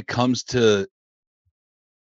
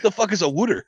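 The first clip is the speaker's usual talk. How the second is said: Philly wooder; 7 semitones higher than usual. Retold or imitated. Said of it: "If I hear Philly".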